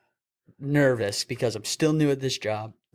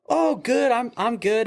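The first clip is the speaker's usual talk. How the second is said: nervous high; 11 semitones higher, 4 dB louder, slower than usual. Retold or imitated. Nervous answer to officer.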